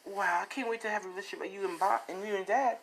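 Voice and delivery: high, rushed, soft gushing; quick high-pitched wonder; wide-eyed admiration. Acted. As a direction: gush in a small high voice. Voicing an admiring kid to parent.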